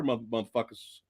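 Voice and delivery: ordinary talk, nothing marked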